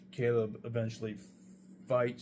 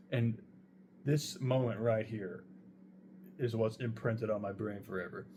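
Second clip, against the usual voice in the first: normal talking voice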